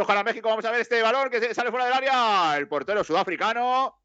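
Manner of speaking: high-pitched match call with stretched words; live play-by-play. Acted. Commentator follows the ball out of play, high-pitched live play-by-play.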